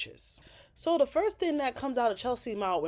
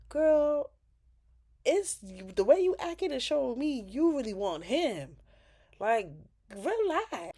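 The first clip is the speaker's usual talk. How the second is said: sassy callout; 5 semitones higher than usual. Real, not acted. Calling Chelsea out.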